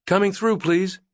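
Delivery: high, loud call to the crowd. A narrator's reading. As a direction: call out over the mob. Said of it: "Hallie called".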